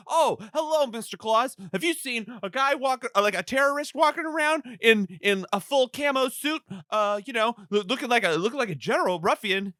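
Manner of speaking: high, mock-polite cop voice; dumb cop voice. Cops quiz Santa about the terrorist, in a high mock-polite voice. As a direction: play the cop as a hammed-up simpleton. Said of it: "The cops are like".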